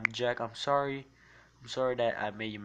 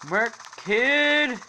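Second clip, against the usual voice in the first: calling out loud; 13 semitones higher, 10 dB louder than usual. Real, not acted. Streamer shouting a player's name across the game.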